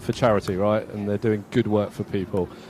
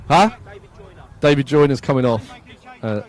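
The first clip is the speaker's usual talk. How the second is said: normal talking voice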